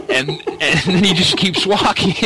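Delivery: high-pitched, laughing through the words; amused, laugh breaking into the story. Real, not acted. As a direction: tell it while cracking up. Cracking up at own story.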